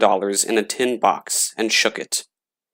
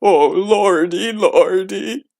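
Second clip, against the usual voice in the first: much higher, slow, terrified moan; frightened moan; 9 semitones higher, 4 dB louder, slower than usual. A narrator's reading. Gravedigger hiding under blankets, frightened high moan. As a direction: moan it out shaking with fear. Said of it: "shivered and shook and moaned".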